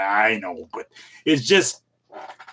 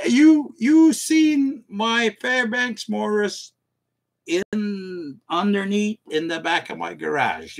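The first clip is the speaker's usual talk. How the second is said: mock quote; 8 semitones higher, slower than usual. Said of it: "reads chat in put-on voice".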